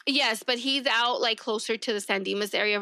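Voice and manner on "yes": normal talking voice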